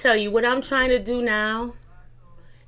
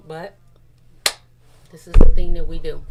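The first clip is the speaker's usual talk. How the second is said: ordinary talk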